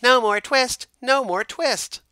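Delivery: very high, loud squeaky shout; twittering shout. A narrator's reading. Mice leap up and shout at the cat, high and loud. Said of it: "shout at once in little twittering voices".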